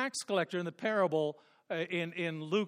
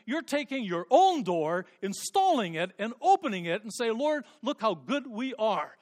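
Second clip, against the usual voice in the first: higher, louder rebuking sermon; fervent preaching; 5 semitones higher, 5 dB louder than usual. Acted. Preacher voicing Jesus rebuking self-made righteousness, loud fervent preaching. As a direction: scold them for building their own door.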